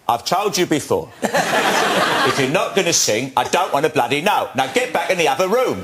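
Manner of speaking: playing the irritated director, half laughing; mock scolding with laughter; stern mock reply. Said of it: "so I went".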